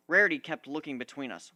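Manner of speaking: plain reading voice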